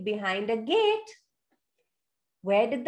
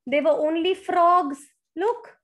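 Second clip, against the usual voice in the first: usual speaking voice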